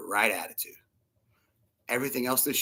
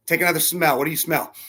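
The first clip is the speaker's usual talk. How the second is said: ordinary talk, nothing marked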